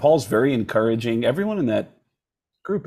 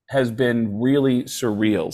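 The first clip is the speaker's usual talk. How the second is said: ordinary delivery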